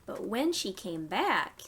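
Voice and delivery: gentle storytime rhyme; reading to children. Acted. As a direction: read it to little ones, pausing for them. Reads a nursery rhyme.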